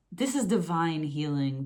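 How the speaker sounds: ordinary talk, nothing marked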